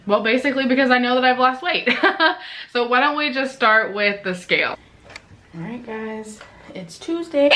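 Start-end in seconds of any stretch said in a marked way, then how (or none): 1.9-2.7 short laugh. Real, not acted. Laughing at her own excitement. 5.5-7.5 talking through hiccups; hiccupy, quiet start. Real, not acted. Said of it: "I have hiccups".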